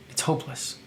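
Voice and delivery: breathy hushed narration; whispered despair. A narrator's reading. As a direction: whisper it, hopeless. Grim narration.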